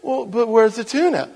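high, loud bewildered cat; clueless cat. Acted. Cat asks owner despite tuna on its nose, loud bewilderment. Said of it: "he looks at you and says".

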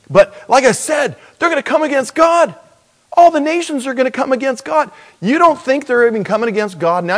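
high-pitched, incredulous preaching, racing along; fired-up preacher in a high, hurried voice; impassioned preaching. Real, not acted.

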